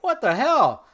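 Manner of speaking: surprised. Retold or imitated. Surprised greeting.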